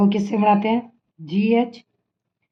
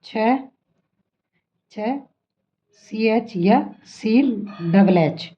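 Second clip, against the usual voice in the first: slow spelling; much slower than usual. Shown as a demonstration. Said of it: "teacher spelling letters".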